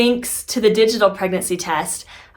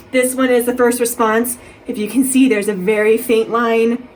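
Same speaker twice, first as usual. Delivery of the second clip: catching breath midword; 3 semitones higher, 4 dB louder than usual. Real, not acted. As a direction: talk through sobbing breaths. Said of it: "Sorry, I'm crying".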